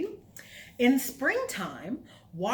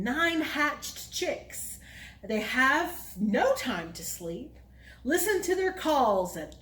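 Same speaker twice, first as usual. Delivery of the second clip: put-on reading to children; 4 semitones higher, slower than usual. Reads counting rhyme.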